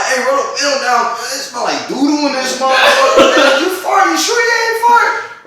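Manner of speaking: hammed-up shocked yell; mock accusing yell. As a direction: shout it at him, hamming up the disbelief. Retelling accusing driver of farting.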